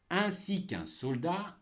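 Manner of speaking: slow, high dictation voice; dictating. Shown as a demonstration. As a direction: dictate slowly and clearly. Dictation of text chunk.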